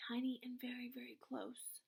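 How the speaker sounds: narrated in a whisper; hushed voice with no tone. A narrator's reading. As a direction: breathe it out, barely audible. Describing tiny voice.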